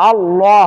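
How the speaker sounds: আল্লাহ with a heavy, rounded 'law'; thick lam. Shown as a demonstration. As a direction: darken and fill the L in আল্লাহ. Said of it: "একটু আগে যেটা বললাম ওটাই". (আল্লাহ-র লাম মোটা করে)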